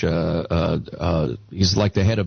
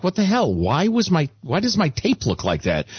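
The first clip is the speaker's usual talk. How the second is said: higher-pitched mock disbelief; imitated bafflement; 7 semitones higher than usual. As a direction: act out puzzled indignation, pitch up then settling. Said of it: "he's like".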